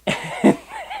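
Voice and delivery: squealing mock giggle of the women; a tiny high-pitched titter. Reviewer mocks the cult women, high giggling titter. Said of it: "the women are like".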